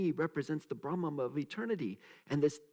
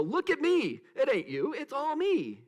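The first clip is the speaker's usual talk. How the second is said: high, loud mock boast; mock self-important guru; mocking egotist teacher; 11 semitones higher, 5 dB louder than usual. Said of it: "show up and go".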